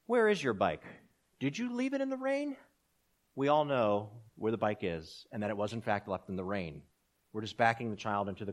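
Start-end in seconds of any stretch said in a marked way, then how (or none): 0.1-2.6 a parent's cornering question, rising high at the end; scolding parent. Retold or imitated. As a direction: back the child into a corner, voice climbing sharply. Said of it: "parents do this all the time".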